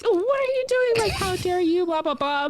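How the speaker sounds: high-pitched imitation of a scolding mom; mock mom yelling. Mom yelling at child from the dock, high mock scolding. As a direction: rattle off a mock telling-off. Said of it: "my mom is yelling at me".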